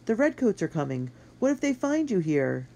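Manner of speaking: high, anxious objection; protesting. A narrator's reading. Boy warning his friend of redcoats, high worried protest. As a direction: argue with rising alarm. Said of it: "protested Matt".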